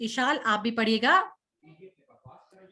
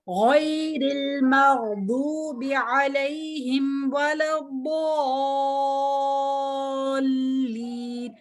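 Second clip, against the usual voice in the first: heavy recitation demo; much slower than usual. Shown as a demonstration. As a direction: model the full verse slowly, thick letters. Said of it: "आपने इसको मोटा करना है".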